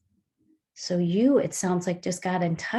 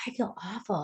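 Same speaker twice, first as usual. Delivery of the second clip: quiet sad; 7 dB quieter than usual. Retold or imitated. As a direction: say it heavy and small. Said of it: "And he's like".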